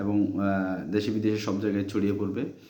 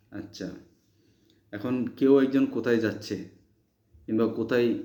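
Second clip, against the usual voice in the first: ordinary delivery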